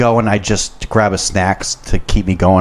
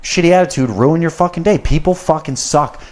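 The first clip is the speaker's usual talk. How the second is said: heated rant; 10 semitones higher than usual. Real, not acted. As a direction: rail against whiny servers. Ranting about servers' complaints.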